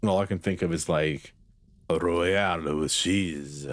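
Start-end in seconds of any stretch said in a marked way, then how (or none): 1.9-3.7 slow, drawn-out fake French accent; mock French accent. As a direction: stretch it out in a hammed-up French accent. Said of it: "a stereotypical French name".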